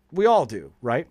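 normal talking voice

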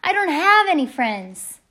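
high, loud holler; carried-away yell; shouted confession. A narrator's reading. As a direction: blurt a secret at full shout.

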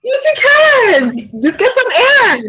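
high, slow, soothing reassurance; high encouraging coo. Real, not acted. Coaxing a crying woman to breathe, high soothing encouragement. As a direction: lift her up gently and slowly.

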